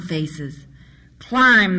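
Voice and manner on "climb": abrupt, louder command from the leader; narrated sudden command, louder; sudden order. A narrator's reading. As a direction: burst out with a sudden loud order. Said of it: "the leader said suddenly".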